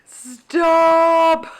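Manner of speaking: high loud drawn-out squeal of stop; long high excited stop; delighted squeal. Real, not acted. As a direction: squeal it, overwhelmed by cuteness. Overwhelmed by cuteness.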